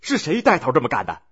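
high-pitched imperious demand; arrogant. A narrator's reading. Deposed emperor confronting the mutinous soldiers, arrogant. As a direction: narrate it as a haughty challenge. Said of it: "还嚣张地问道".

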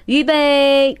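loud coach barking orders; barking gym coach. A narrator's reading. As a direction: bark it like a coach at a meet. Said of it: "教练发布命令".

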